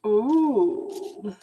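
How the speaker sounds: ordinary talk, nothing marked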